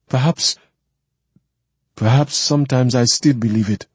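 a hint of hurt, halting at perhaps; lightly voiced wounded woman. Woman admitting she once believed his dream, lightly wounded.

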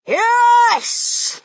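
high, loud triumphant yes; thundering. A narrator's reading. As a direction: boom it out. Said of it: "thundered the Grand High Witch".

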